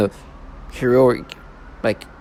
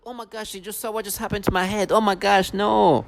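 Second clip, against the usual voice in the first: high, breathy mock shock, much higher; mocking gasp; 7 semitones higher, 4 dB quieter than usual. Mocking people who gasp at his head, in a high mock-shocked voice. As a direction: sneer at their fake amazement in a high voice.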